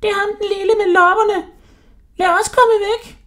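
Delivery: very high, panicked squeal; screaming women. A narrator's reading. Sultan's wives fleeing the lad with fleas, shrill screaming. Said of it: "skreg de".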